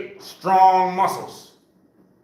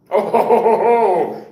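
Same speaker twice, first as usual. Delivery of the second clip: ho-ho chuckle, a little louder; hearty laugh; 4 semitones higher, 5 dB louder than usual. Real, not acted. Coach laughing at students scared to stand, chuckling. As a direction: laugh it out in round "ho" syllables.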